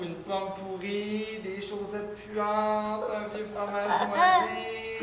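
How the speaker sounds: slow, drawn-out reading of smelly things; storybook reading. Acted. Teacher reading a story to the class, slow and expressive. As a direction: savour each nasty smell slowly.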